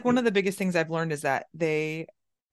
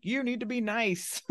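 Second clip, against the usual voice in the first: higher-pitched mock scolding of a child; a hint of a nagging mom, pitched up; lightly mocked nagging parent; 8 semitones higher than usual. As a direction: lightly put on a preachy parent voice.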